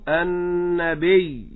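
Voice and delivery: النبي very slow, falling from the ba; stress on the ba. Shown as a demonstration. Teacher repeats النبي as the model. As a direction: stretch النبي and press the ba. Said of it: "فنقول".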